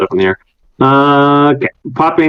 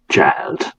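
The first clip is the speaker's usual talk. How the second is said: softly dismissive, almost voiceless; hushed, patronizing address; condescending; 6 dB quieter than usual. Acted. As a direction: drop the word quietly, talking down. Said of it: "very condescendingly".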